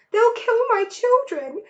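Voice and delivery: panicked mother; crying out. A narrator's reading. Mrs Fox to her husband, terrified for her cubs. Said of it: "cried Missus Fox".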